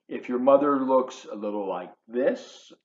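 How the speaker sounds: plain reading voice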